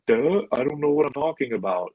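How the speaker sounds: mock dim-witted "duh" voice; Jack Hanna. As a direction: lightly play the dopey animal guy.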